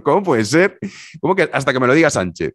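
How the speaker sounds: can't believe what you just heard; loud stunned disbelief at the answer; incredulous. Retold or imitated. Incredulous retort to the waitress.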